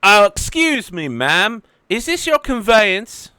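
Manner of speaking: put-on high, loud childlike voice of a big man; gentle giant. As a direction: ask politely like an overgrown kid. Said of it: "and is like".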